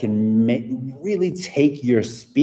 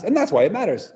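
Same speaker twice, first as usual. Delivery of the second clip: quick, higher-pitched delivery; fast; 10 semitones higher than usual. Shown as a demonstration. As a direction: snap it out quickly. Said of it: "really fast".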